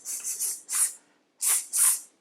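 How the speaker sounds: unvoiced hissed s; repeated hissed s; hissing s. Shown as a demonstration. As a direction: hiss the s sound. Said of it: "start with s".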